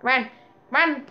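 normal talking voice

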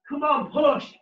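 ordinary talk

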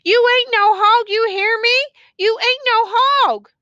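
high, loud, impassioned cry; desperate insistence. A narrator's reading. Woman desperately pulling prisoner over.

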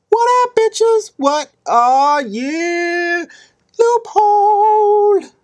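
very high, loud falsetto whooping; mock celebration. King celebrating his loophole, high drawn-out whooping. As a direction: squeal and stretch the words in triumph.

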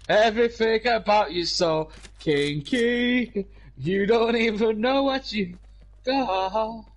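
high, slow, husky come-on; hammed-up sexy voice; sultry tease. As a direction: play a mock seductive crooner. Teasing a mate with sultry voice.

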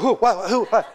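a bewildered, high who-what; startled. Retold or imitated. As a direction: act jolted and confused. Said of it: "you're like".

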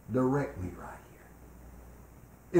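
hushed, slow, breathy plea to the Lord; whispered prayer. Shown as a demonstration. Preacher showing the church how to pray, whispered slowly. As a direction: drop to a slow whisper, praying under your breath.

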